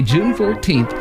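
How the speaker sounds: usual speaking voice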